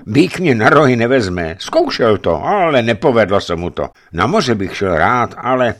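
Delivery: proud but unsure; young giant voiced. A narrator's reading. The giant replies to Savelij, lightly voiced and boastful.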